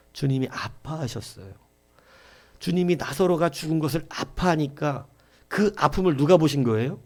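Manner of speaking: ordinary delivery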